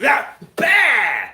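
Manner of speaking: high, loud fight-move noises; mock martial-arts attack cries; imitated strike yells. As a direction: punctuate mock kicks with sharp yells. Acting out Van Damme's kicks.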